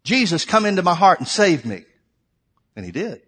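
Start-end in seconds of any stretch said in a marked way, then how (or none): 0.0-1.8 loud, raised cry of prayer; retold shouting. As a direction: shout a plea to Jesus, reenacting it. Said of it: "I started shouting".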